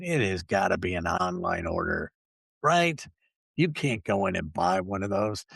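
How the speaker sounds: ordinary talk, nothing marked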